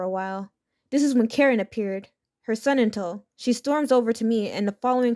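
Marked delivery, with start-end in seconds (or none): none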